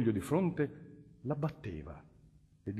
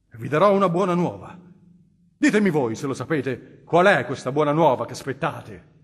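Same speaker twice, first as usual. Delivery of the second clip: loud, bitter challenge; anguished outburst; 3 semitones higher, 12 dB louder than usual. Acted. The tormented nobleman defies the cardinal in an anguished loud outburst. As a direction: throw the good news back in his face. Tormented man lashes back.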